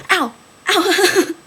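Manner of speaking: loud, high ow; sudden outburst of real pain; real pained yelp. Real, not acted. Sudden pain, hands sore.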